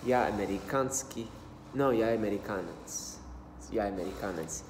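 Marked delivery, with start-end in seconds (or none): none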